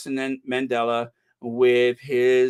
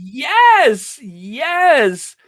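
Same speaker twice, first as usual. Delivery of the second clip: loud, high, drawn-out yes; thrilled and whooping; excited cheer; 10 semitones higher, 10 dB louder than usual. Real, not acted. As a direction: cheer the news on, pitched way up. Thrilled at viewer's volunteering news.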